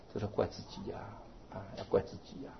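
whispered self-reproach; hushed. Real, not acted. Monk whispering that we must blame ourselves. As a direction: whisper it as a lesson.

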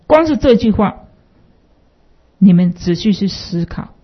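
normal talking voice

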